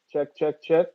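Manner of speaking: quick flat sound check into the mic. Real, not acted. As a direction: test the line, check check. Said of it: "Am I good?".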